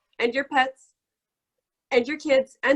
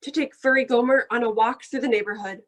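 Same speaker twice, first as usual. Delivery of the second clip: ordinary delivery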